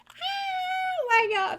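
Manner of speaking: very high, slow breathy squeal; swooning. Retold or imitated. Watching the episodes, a swooning high squeal. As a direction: melt into it, stretched out. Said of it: "I'm just like".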